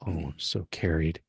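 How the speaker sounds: ordinary delivery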